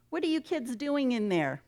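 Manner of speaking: put-on high, singled-out mom voice questioning the kids; acted mom's knowing question; mother checking up. A narrator's reading. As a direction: call through the door like a suspicious mother.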